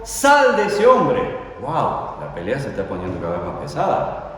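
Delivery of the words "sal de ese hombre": slow, much higher and louder command; commanding shout. Acted. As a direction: order the spirit out with full force. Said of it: "Lo reprendió". (orden gritada, lenta y muy aguda)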